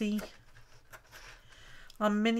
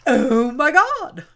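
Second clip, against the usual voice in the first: astonished; 4 semitones higher, 9 dB louder, slower than usual. Real, not acted. Astonished at what she sees.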